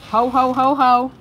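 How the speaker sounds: hammed-up Santa ho ho ho; Santa laugh. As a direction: belt out a jolly Santa laugh. Santa ho-ho exchange.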